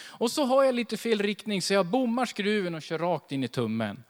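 ordinary delivery

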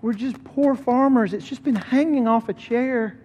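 put-on high, teary old man's voice; humble old farmer, voice high and moved; tearful old man. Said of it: "he says".

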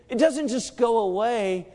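normal talking voice

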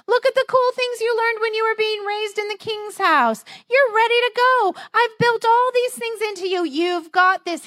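high, upbeat cheerleader voice; pep-talk buddy. Pretend. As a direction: hype him up like a coach. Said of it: "God could say to him".

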